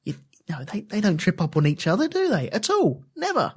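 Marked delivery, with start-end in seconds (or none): 2.1-3.5 sarcastic disbelief. Acted. Mock disbelief about qualifying.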